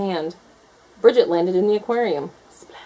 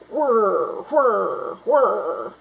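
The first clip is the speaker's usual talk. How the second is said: robot whir; 3 semitones higher, much slower than usual. A narrator's reading. Robot marching noise.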